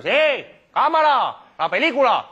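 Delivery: loud, high shouted warning; high-pitched, urgent calling out; calling out in alarm. Acted. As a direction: shout it across the cinema. Shouting as the film burns.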